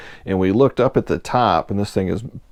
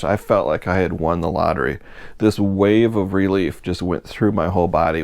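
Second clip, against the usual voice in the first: normal talking voice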